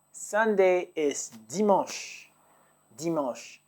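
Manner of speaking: very slow, dimanche drawn out with a nasal ending; very slow teaching delivery. Shown as a demonstration. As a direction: draw out dimanche for learners. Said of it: "teacher models word".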